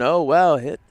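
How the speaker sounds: a hint of a sleepy old broadcaster perking up; lightly imitating an old man calling a hit; mock old announcer. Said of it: "talk like this and never really move".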